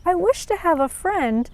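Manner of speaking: high, small voice of a sad little flower; a shy flower holding back tears; little flower, tearful. Acted. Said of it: "held back a tear and said".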